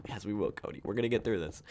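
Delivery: hushed promise to his lizard Cody; soft reassurance. Retold or imitated. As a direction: soothe a scared pet quietly. Comforting his pet lizard.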